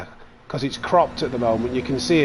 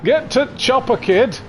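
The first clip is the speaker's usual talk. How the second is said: much higher, louder Arnie bark; hammed-up Arnie; 10 semitones higher, 3 dB louder than usual. Streamer keeps hamming up Arnie for chat, higher and louder. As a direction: shout it like an action hero. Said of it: "What does he say?".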